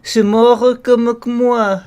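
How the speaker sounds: high, loud, drawn-out mock French; hammed-up European French; European French accent. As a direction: wail it like a melodramatic Frenchman. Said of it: "that's my European French accent".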